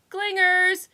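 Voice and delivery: high, loud call from far off; distant relay. A narrator's reading. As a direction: echo it from down the hall. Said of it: "relayed a distant voice".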